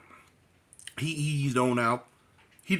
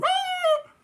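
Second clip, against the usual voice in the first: mock holler; 3 dB louder than usual. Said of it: "my butt was hollering".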